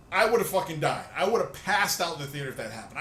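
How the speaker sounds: usual speaking voice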